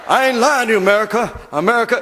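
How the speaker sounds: loud, high Bernie Mac voice; hammed-up Bernie Mac shout; Bernie Mac impression. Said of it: "sounds like Bernie Mac".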